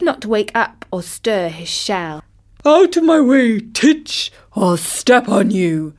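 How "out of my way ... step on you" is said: big elephant's loud, high-starting call. A narrator's reading. Elephant warns sleeping tortoise off his path, calling out. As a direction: shout a bossy warning, then slow down. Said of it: "called out the elephant".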